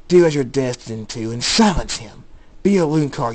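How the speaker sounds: loud, swelling order; forceful command. A narrator's reading. Voice in Jacob's head ordering him, forceful command. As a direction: urge him on with rising force.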